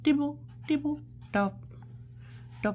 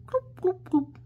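clip-clop; 13 semitones higher than usual. Shown as a demonstration. Repeating horse noise.

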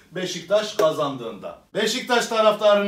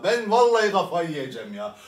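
normal talking voice

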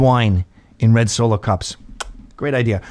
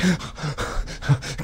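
panicked gasp; 2 semitones higher, 9 dB quieter than usual. Retold or imitated. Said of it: "I'm like".

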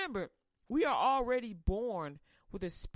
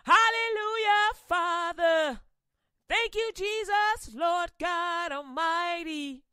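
much higher and louder, nearly sung hallelujah; ecstatic praise; 9 semitones higher, 11 dB louder than usual. Acted. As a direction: shout your thanks to heaven in ecstasy. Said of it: "Enos is going".

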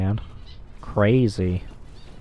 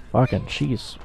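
usual speaking voice

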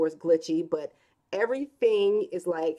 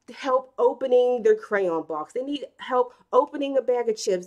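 normal talking voice

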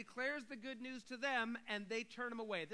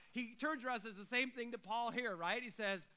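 ordinary delivery

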